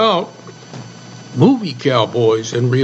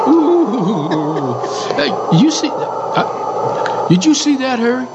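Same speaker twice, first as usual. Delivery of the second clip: mock surprise; 5 semitones higher than usual. Joking about penguins in desert.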